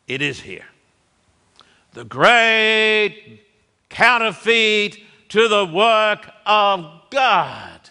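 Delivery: normal talking voice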